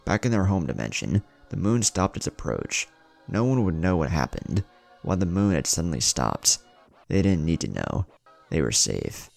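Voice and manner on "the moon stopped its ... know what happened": ordinary delivery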